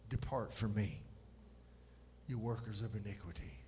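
low, solemn and measured judge's verdict; grave sentence of judgment. Acted. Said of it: "some of the scariest words in the Bible".

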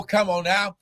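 usual speaking voice

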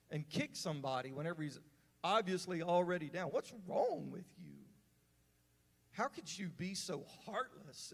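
indignant outburst. Retold or imitated. Preacher recalling his anger at a cruel kid, heated indignation. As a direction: let the outrage at the punk spill out. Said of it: "in my heart, I thought".

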